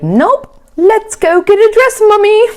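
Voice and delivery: very high, loud singsong imitation of an eager daughter; squeaky-high mock excitement; mock-chirpy daughter. Perky retelling of daughter's reply.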